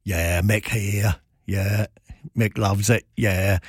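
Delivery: light imitation of a slow, lazy London working class accent; slow mock cockney rock star, lightly put on. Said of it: "[name] has your working class accent".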